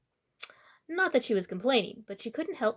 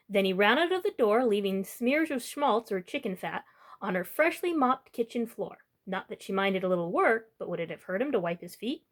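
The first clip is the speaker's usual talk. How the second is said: plain reading voice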